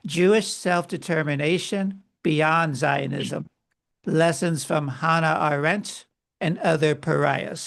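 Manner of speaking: book title said slowly, in separate phrases; slow down and space out the title; measured, deliberate pace with pauses between phrases; slow repeat. Shown as a demonstration. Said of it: "say that slowly one more time".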